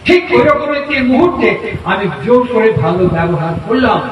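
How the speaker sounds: ordinary talk, nothing marked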